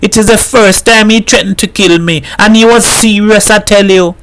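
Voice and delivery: high, urgent insistence; frightened. A narrator's reading. Missus Herrera insists to the mother, high and frightened. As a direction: make them believe the danger.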